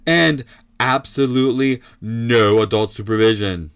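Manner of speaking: punchy ringside call; fight commentator. Acted. Commentator as the news sneaks one back, emphatic play-by-play. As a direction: hit each item like a landed punch.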